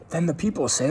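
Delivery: ordinary delivery, nothing marked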